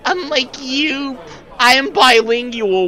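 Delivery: smug mockery. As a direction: sneer it out slowly.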